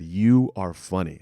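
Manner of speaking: slow, firm "You are funny."; slowed intense "You are funny."; repeated insistence. Retold or imitated. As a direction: say it slowly to make him believe. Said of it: "she kept saying".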